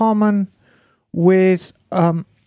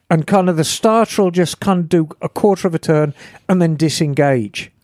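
usual speaking voice

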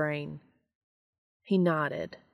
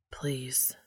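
soft unvoiced plea; breathed whisper; 4 semitones lower, 8 dB quieter than usual. A narrator's reading. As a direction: breathe the word pleadingly. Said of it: "Dean breathed".

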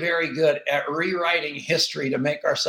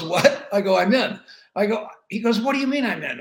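surprised; 6 semitones higher, 3 dB louder than usual. Retold or imitated.

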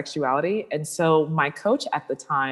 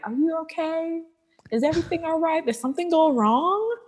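much higher, fussing mock-concerned voice; worried church lady; 12 semitones higher than usual. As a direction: play a fretting churchgoer, pitched way up.

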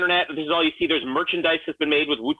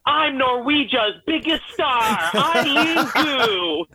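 high, slow put-on Norwegian accent; proud mock Norwegian boast; 7 semitones higher, much slower than usual. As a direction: ham up a fake Norwegian accent. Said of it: "my Norwegian accent".

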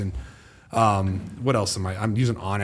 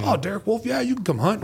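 high, friendly landowner impression; lightly voiced homeowner, high and friendly; mock homeowner voice; 10 semitones higher than usual. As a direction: pitch it up as the obliging neighbor.

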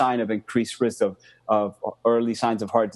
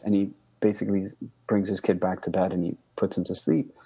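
ordinary talk

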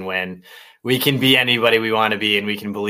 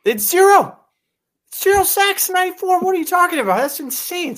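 much higher, disbelieving exclamation; incredulous excitement. Real, not acted. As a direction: blurt it out amazed at the number. Stunned by zero sacks stat.

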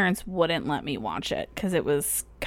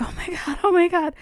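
starstruck gasp; 9 semitones higher, faster than usual. Retold or imitated. Fan spotting the famous director beside her, rising excitement. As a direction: gush like you just spotted a celebrity.

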